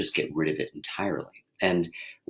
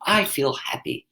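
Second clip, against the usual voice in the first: bright; 7 semitones higher than usual. Shown as a demonstration. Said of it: "So also try on".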